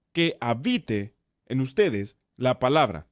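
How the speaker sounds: slow reading with "habite" set off by a pause; stress on habite. Shown as a demonstration. Teacher highlighting another important word, stress on "habite". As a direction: lean on "habite", then pause before going on. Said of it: "Es otra palabra muy importante".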